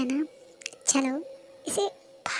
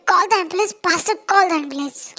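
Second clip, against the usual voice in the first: loud urgent yelling; frantic shout for help; 6 dB louder, much slower than usual. Acted. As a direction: yell for an ambulance. Demanding an ambulance.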